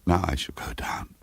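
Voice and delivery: whispered, steadying herself; shaky whisper. A narrator's reading. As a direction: whisper it to gather courage. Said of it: "she stopped crying and said".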